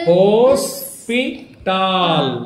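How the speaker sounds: హోస్పిటాల్ drawn out very slowly; syllable-by-syllable sounding out. Shown as a demonstration. Teacher sounds out the word for pupil, syllable by syllable. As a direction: hold each syllable: hose, పి, tall. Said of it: "hospital అని పలకాలి word".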